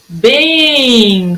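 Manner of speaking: "bem" held long; lingering stress on "bem"; stretched syllable. Shown as a demonstration. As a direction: draw out "bem" to show its length. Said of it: "foi a sílaba que durou mais".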